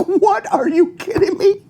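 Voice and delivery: high-pitched, laughing disbelief; laughing incredulity. Acted. Reacting to goofy seatbelt questions put to Taj, laughing incredulity. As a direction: scoff in amazement while laughing.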